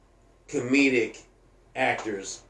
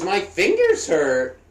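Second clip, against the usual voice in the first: put-on slow, higher old-lady complaint; imitated old lady; 5 semitones higher, slower than usual. Old lady complaining to the orderly, imitated slow high voice. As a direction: whine it slowly as a mock old lady. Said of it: "And she goes".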